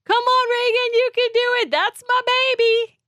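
imitating a mom's high, excited yell; mom screaming cheers. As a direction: cheer her on like a proud mom in the crowd. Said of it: "I heard my mom screaming".

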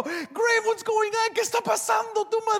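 high, alarmed questioning; hammed-up high-pitched alarm; panicked devil.

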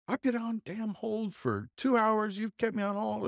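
high-pitched, slowed furious retelling; replaying his fury at being kept waiting; angry complaint. Angrily retelling his complaint to the phone company lady. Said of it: "'cause I was so angry".

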